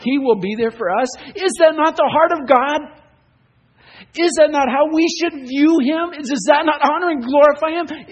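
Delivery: loud, high, rising sermon climax; preaching at full force. Acted. Preacher pressing the congregation with rising force. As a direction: pound each question home to the congregation.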